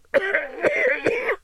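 high hacking cough; rough cough. Acted. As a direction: cough harshly. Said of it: "little wheezes from DeSantos".

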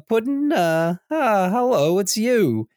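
high, hesitant phone greeting; Harley Quinn. Retold or imitated. Harley answering the Joker's call, lightly voiced and hesitant. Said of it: "And Harley is".

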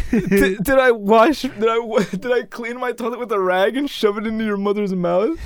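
high-pitched hammed-up protest; mock indignation. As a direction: plead innocence in mock outrage. Absurd mock protest to teacher.

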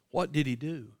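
normal talking voice

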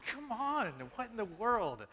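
high, whiny "come on" in mock protest; mock exasperated plea. Preacher retelling his protest to God, mock exasperated and high. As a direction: plead like a hungry kid denied breakfast.

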